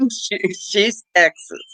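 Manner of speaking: mock Texas twang, lightly played; Texas accent. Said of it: "she talks like this".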